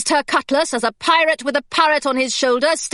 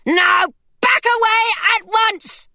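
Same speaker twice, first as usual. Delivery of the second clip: much higher, slow order to back off; commanding shout; 10 semitones higher than usual. A narrator's reading. Hilary ordering the pirate away, high and commanding.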